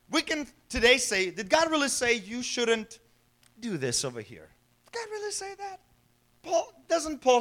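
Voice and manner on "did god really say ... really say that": a hint of a hushed, sly serpent. Preacher voicing the serpent to the congregation, softly sly. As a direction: slip the doubt in softly, like the snake.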